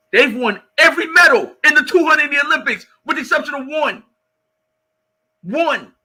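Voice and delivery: emphatic shout. Real, not acted. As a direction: shout the stat to end the argument. Coach driving home point.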